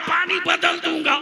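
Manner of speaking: shouted high and loud in retold anger; angry maulvi. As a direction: snap it back as the furious cleric. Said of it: "गुस्से में मौलवी बोल पड़ा".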